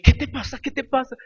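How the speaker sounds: high-pitched imitation of an alarmed wife; startled wife. Wife woken by crying husband, imitated in high alarm. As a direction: play her woken up and alarmed.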